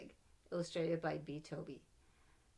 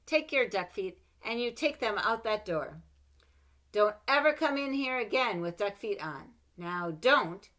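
firm, raised mom voice; scolding mother; 5 semitones higher, 12 dB louder than usual. Acted. Mother ordering her child out the door, stern. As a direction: tell the kid off like a cross mother. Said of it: "She would say".

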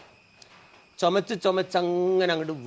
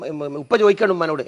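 usual speaking voice

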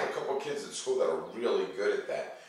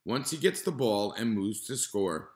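put-on calm, even read-aloud voice for kids; reading to children. Teacher reading a nonfiction book to students, calm and clear. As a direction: read the page clearly to the class.

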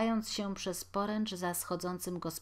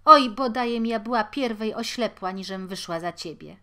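much louder bitter wail; bitter curse; 3 semitones higher, 10 dB louder than usual. A narrator's reading. Wife to her husband, a loud bitter lament. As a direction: curse the day you married.

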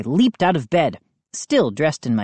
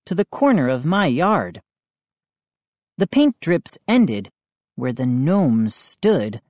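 ordinary delivery

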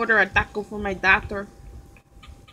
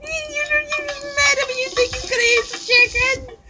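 very high put-on voice; high character voice. Character disputing a chicken order, in a high squeaky voice. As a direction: squeak it in playful disbelief.

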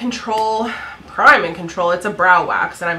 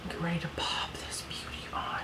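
whisper; 3 semitones lower, 14 dB quieter than usual. Real, not acted. Applying lipstick.

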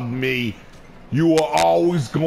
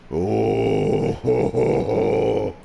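evil laugh; 6 semitones lower, much slower than usual. Acted. Evil laugh while playing.

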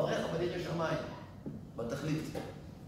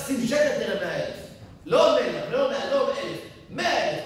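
rising, louder preacher's promise; fervent promise; 7 semitones higher, 7 dB louder than usual. Real, not acted. Preacher promising the crowd a hundredfold return, fervent shout. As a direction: hammer home the hundredfold payback.

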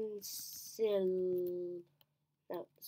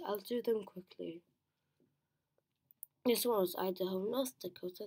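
normal talking voice

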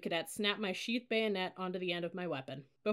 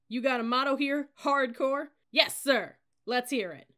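raised, loud military call-and-response; drill exchange; 6 semitones higher, 8 dB louder than usual. A narrator's reading. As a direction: project it like a drill instructor and cadets. Cadet leader addressing assembled new cadets.

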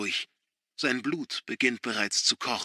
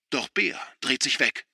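ordinary delivery, nothing marked

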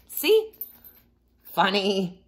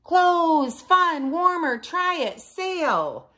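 high eager pitch; selling it with zeal; excited sales pitch; 12 semitones higher, 3 dB louder, slower than usual. A narrator's reading. Wilbur pitching a store.

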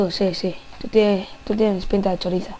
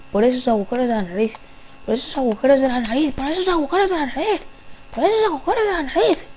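fast, each repeat higher, ending much higher; rising taunt; 4 semitones higher, much faster than usual. Acted. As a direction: needle them faster and higher. (burla rápida cada vez más aguda)

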